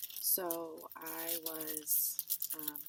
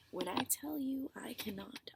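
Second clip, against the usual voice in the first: whisper; 7 semitones higher than usual. Retold or imitated. Panicked retelling, whispered.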